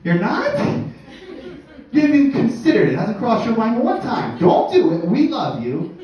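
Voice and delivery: hushed then high worried voice; overly concerned friends. Retold or imitated. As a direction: fuss over him anxiously. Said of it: "They're like".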